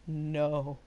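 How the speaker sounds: soft, low, stunned no; quiet no. Retold or imitated. Teacher to smiling student, a low quiet no. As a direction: barely breathe the word. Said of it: "Quietly said".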